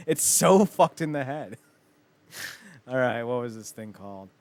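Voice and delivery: ordinary talk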